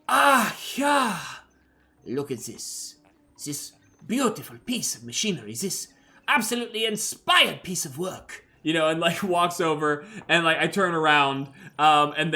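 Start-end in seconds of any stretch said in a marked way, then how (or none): 0.1-8.4 trader voice. Acted. Trader hawking wares.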